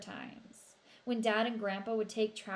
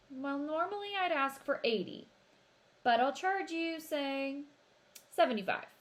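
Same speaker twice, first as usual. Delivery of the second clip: haggling; 6 semitones higher than usual. A narrator's reading. Shopkeeper haggling.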